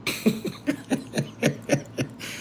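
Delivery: high, quiet wheezing laugh; helpless, breathy giggling; real stifled high laugh. Real, not acted. Laughs at Brittany's explanation.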